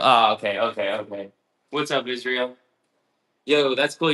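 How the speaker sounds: ordinary delivery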